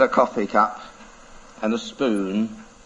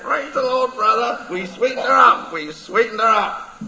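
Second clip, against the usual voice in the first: high, laughing parrot cry; triumphant parrot; 9 semitones higher than usual. Acted. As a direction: crow the victory like a parrot.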